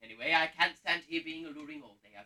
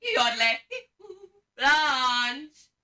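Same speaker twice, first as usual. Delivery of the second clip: loud yodel-like cry then Blanche; shouted warning call; 6 semitones higher, 9 dB louder, much slower than usual. Shown as a demonstration. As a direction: demonstrate the alarm call for the audience. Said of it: "shout out like this".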